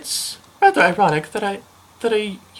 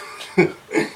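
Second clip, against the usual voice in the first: a short breathy chuckle; little laugh. Acted. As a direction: laugh a little before speaking. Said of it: "couldn't help but laugh a little".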